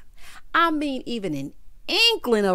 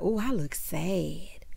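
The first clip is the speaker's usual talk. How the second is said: whispered aside; 3 semitones lower, 5 dB quieter, slower than usual. Retold or imitated. Sheree sees her photo.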